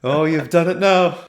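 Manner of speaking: slow, mock-ominous Irish accent; Canadian attempt sliding into a slow Irish lilt; accidental Irish accent. Guard after the tree is hit, Irish accent. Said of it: "Again, it's going Irish".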